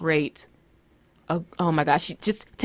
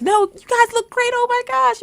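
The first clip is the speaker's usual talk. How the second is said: high squealy fan voice, much higher; imitating a starstruck fan, high-pitched; gushing fan. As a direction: gush like an excited fan. Fans gushing over the cosplayers.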